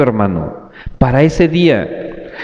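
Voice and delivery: ordinary talk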